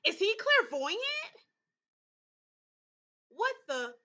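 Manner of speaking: high, loud startled question; suddenly realizing something; surprised. Real, not acted.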